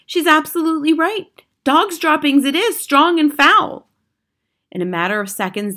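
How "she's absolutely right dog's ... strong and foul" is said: high, loud, excited agreement; crying out. A narrator's reading. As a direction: confirm it as a loud alarm. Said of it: "cried another voice".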